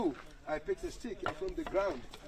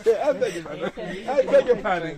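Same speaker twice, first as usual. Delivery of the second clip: laughing apology; 4 semitones higher, 10 dB louder than usual. Real, not acted.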